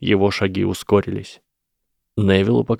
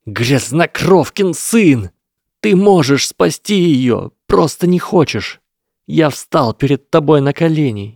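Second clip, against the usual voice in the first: furious scream; 6 semitones higher, 6 dB louder than usual. A narrator's reading. Lesath screams at Harry for refusing to help. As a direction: scream betrayal at him. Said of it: "Его крик резанул Невилла по ушам".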